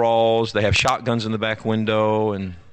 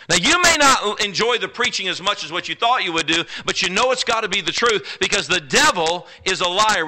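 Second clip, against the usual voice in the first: loud, high preaching; fired-up preacher; 8 semitones higher, 5 dB louder than usual. Acted. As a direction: press the truth on the crowd. Preacher at full force.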